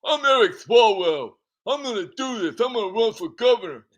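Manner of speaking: pissed-off Eric. Retold or imitated. Eric defies Nancy after being told no, mock angry defiance. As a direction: puff up and defy her in a put-on voice. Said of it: "Eric was probably pissed off".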